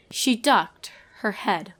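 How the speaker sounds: ordinary delivery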